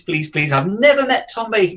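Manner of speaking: ordinary talk, nothing marked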